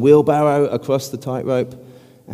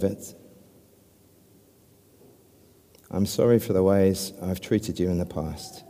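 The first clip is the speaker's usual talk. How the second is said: slow, soft prayer, long pauses between lines; 4 semitones lower, 5 dB quieter than usual. Real, not acted. Preacher leading the congregation in prayer, slow and soft. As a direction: lead the prayer line by line for them to echo. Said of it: "I'll pray it slowly, line by line".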